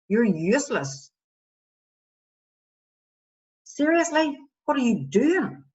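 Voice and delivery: acted scathing inner critic; exasperated, disgusted telling-off of yourself; harsh self-talk. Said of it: "change in tone in my voice when I'm talking to myself".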